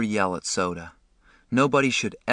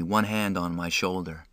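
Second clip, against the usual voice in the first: plain reading voice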